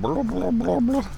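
high, babbling gobble sounds; turkey gobble. Retold or imitated. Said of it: "gobbling around".

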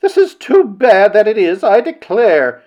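high, slow, loud lament; tearful outburst. A narrator's reading. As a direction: exclaim as if about to burst into tears. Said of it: "bursting into tears".

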